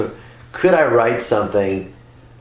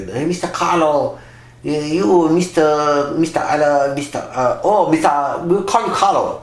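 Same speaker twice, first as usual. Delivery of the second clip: a hint of a Chinese bank clerk accent, higher; lightly voiced Chinese accent, fumbling the name; mild imitation of a Chinese accent; Chinese accent impression; 5 semitones higher than usual. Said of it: "they were Chinese guys".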